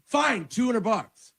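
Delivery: very high, drawn-out mock exasperation; grudging concession. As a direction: give in high and slow, imitating annoyance. Retold comedian grudgingly raises offer.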